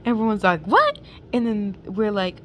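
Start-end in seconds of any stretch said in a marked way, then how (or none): 0.7-1.0 loud, very high rising yelp; mock shock. Everyone reacting to us saying sure, mock shock. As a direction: shoot the pitch right up in disbelief.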